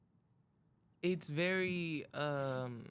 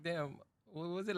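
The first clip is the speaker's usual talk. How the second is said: ordinary talk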